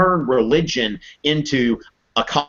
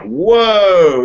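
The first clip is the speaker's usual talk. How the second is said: loud, higher startled whoa; 6 semitones higher, 8 dB louder than usual. Retold or imitated. Jesus reacting to a tricky question, startled. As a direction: blurt it out, caught off guard. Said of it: "And Jesus is like".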